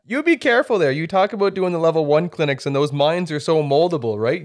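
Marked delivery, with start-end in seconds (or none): 0.1-0.9 mock warning. Real, not acted. Host teasing warning to guest.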